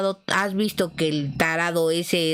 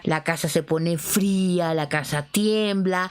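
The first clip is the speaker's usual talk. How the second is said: normal talking voice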